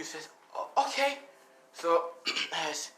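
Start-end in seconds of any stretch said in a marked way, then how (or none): 0.6-1.2 put-on high, stammered little boy agreement; a scared child giving in; hesitant boy.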